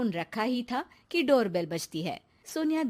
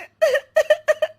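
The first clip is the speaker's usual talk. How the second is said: high sob; 13 semitones higher, 10 dB louder than usual. Acted. Sonia breaks down on phone.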